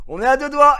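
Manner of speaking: high and loud playful repeat; mock warning. Repeating the warning to the banker, high and loud. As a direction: say it again, still loud and teasing.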